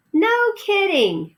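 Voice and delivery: high, slow, stretched no kidding; wide-eyed mouse disbelief; amazed mouse. Acted. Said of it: "Ralph laughed".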